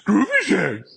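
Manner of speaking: high, loud cartoon dog cry; Scooby-Doo. Shown as a demonstration. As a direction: call out as an excited cartoon dog, badly faked. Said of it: "another impression of Scooby-Doo".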